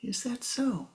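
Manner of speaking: soft, unbothered reply; calm acceptance. Acted. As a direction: let it go gently.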